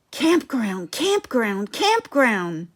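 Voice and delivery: plain reading voice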